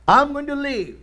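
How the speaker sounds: a bold leap of faith in the voice; triumphant declaration. Retold or imitated. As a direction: pitch jumps up on I'm going to live. Patient declaring faith defiantly.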